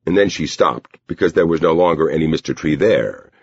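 usual speaking voice

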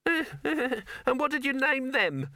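very high, slow, sarcastic rabbit voice; grudging rabbit. Acted. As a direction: needle him slowly, a high rabbit holding a grudge. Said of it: "wasn't the kind ... forgot a grudge".

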